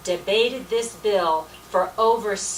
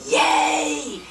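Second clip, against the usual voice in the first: imitated vote shout; 4 dB louder than usual. Team shouting yes in vote.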